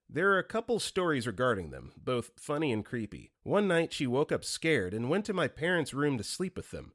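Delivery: ordinary talk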